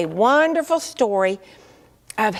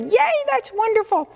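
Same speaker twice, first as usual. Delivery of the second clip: a much higher, bright cheering crowd voice; mock crowd cheer; 12 semitones higher than usual. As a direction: cheer like the whole room is celebrating. Everybody's cheering.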